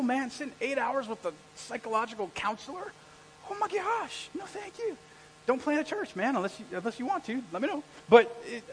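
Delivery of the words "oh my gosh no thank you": high, hushed aversion; mock dread. Preacher recalling eight hours with counselor, high mock dread. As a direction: recoil softly in pitched-up disbelief.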